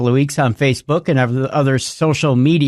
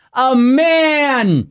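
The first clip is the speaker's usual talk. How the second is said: dramatic reveal; 9 semitones higher than usual. Pretend.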